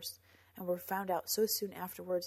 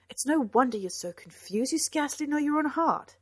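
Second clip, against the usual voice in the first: raised, higher, louder reproach; exasperated reproach; 7 semitones higher, 9 dB louder than usual. A narrator's reading. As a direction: burst out in frustration at a loved one. Upset outburst in a quarrel.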